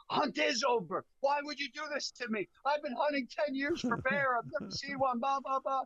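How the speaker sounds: very high-pitched mock wail of complaint; mimicked upset friend. Friend to guide who ended the hunt, hammed-up upset. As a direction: imitate a buddy whining in protest, voice way up.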